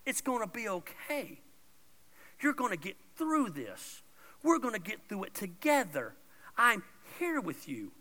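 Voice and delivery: soft, calm comforting voice; quiet, tender encouragement; gentle reassurance. Pretend. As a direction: talk someone down from anxiety softly. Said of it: "I'm just saying".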